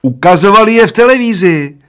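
put-on high, loud old man's shout. A narrator's reading. First old man insisting it was on television, shouting. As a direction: yell it in a high voice. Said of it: "křičel první dědek".

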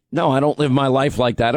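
usual speaking voice

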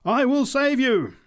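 very high, loud proclamation; top of voice. A narrator's reading. Preacher promises the smoker, shouting at full voice. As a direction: shout salvation at him. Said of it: "at the top of her voice".

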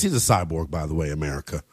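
dropped low and soft; a low mock-serious aside; deep aside. Deep aside about a player.

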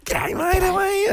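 high, cheery mate's shout; booming Aussie g'day; hearty shout. Retold or imitated. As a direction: call out a big g'day to a mate. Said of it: "And they go".

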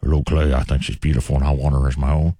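put-on low creepy old man voice. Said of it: "I'll be like".

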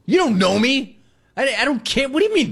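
high, exasperated mock protest; hammed-up grumpy customer. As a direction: throw up your hands in mock annoyance. Ranting at nosy bank small talk.

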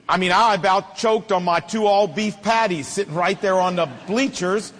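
ordinary delivery

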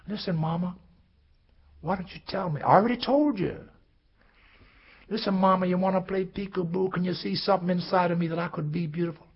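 put-on high little-boy voice; high boy voice pleading with mama; pleading child. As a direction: ask mama like a small boy.

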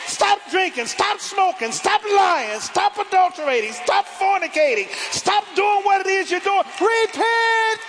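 high-pitched, hammering list of commands; crying out. Real, not acted. Preacher urging the congregation to stop sinning, crying out. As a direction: cry out each command in full preaching voice. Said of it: "I'm crying out to you today".

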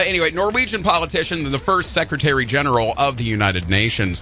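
normal talking voice

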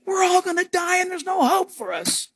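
mock despair; panicked disciple. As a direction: play a scared fisherman in the boat.

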